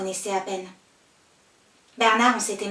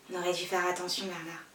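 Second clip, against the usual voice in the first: subdued worry; 5 semitones lower, 9 dB quieter than usual. Acted. Confronts him on the sidewalk.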